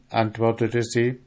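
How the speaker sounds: usual speaking voice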